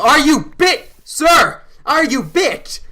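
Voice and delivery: loud, high demanding shout. Retold or imitated. Storyteller confronting the man asking for money, shouting. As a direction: shout it like an urgent interrogation. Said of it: "just start shouting".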